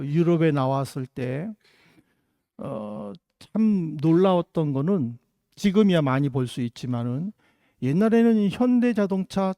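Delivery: ordinary talk, nothing marked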